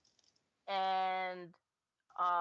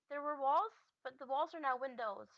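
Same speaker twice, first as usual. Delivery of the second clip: high-pitched little mouse voice; 7 semitones higher than usual. Acted. Mouse reporting back from upstairs, high squeaky voice. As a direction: pipe it up high as a small creature. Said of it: "Mouse then goes".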